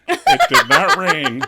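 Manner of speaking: high, loud, slow emphatic denial; insistent. Retold or imitated. Weather guy flatly insisting to the anchor, loud and slow. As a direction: hammer each word in mock outrage.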